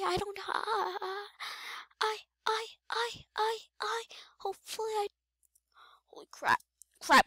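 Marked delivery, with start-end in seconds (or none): 0.0-5.1 high hushed whisper; whisper, trying not to be heard; whispering while hiding. Real, not acted.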